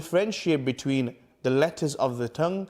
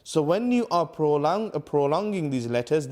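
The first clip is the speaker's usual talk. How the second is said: ordinary talk, nothing marked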